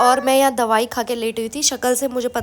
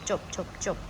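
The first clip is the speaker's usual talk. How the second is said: soft hush; 5 semitones lower, 12 dB quieter, faster than usual. Real, not acted. Mother hushing crying baby.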